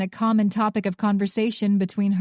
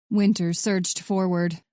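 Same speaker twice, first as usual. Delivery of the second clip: usual speaking voice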